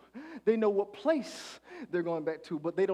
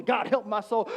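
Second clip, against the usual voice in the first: usual speaking voice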